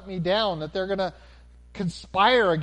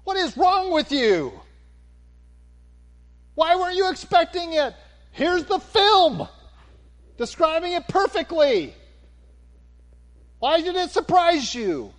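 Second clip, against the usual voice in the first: berating in a raised, incredulous voice; indignant scolding; 11 semitones higher, 7 dB louder than usual. Pretend. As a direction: demand answers like an exasperated coach. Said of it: "what we ought to be saying".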